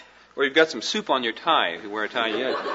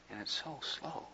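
very slow and much softer, imitating a measured speaker; Joe Noble's slow way; 20 dB quieter than usual. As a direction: drop to a near hush and stretch every word like Joe. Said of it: "he just speaks like this".

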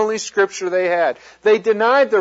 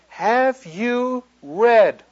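"Have you read" very slowly, word by word, raised pitch; stress on read; 5 semitones higher, much slower than usual. Real, not acted. Preacher challenging listeners on Scripture, slow stress on "read". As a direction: space out each word and land firmly on "read". Contrasts reading with encountering.

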